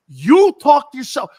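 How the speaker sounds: high, loud, slow echo of the scolding; repeating the yell. Retold or imitated. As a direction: fling his words back at him, slowly and loudly. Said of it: "and she goes".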